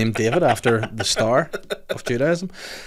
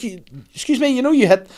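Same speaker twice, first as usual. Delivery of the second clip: high, loud indignant call; 13 semitones higher, 5 dB louder than usual. Retold or imitated. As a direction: call after her, indignant.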